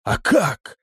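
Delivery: high, astonished question; eager surprised query; amazed. A narrator's reading. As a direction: ask it in wonder. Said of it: "сильно изумился Крепыш".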